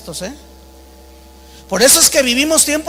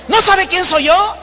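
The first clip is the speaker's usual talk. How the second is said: high, raised-voice mock challenge, rising at the end; acted haughty outrage; 7 semitones higher, 4 dB louder than usual. Famous preacher challenging the clerk, acted haughty outrage. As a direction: imitate a self-important man pulling rank.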